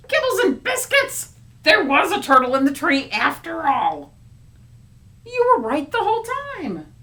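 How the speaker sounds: amazed loud exclamation; surprised. Acted. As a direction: blurt out in amazement.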